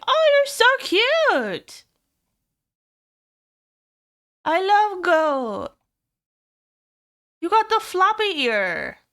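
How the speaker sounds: drawn-out squeal of adoration; cutesy coo. Real, not acted. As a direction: coo at it like a puppy. Cooing over a viewer's avatar.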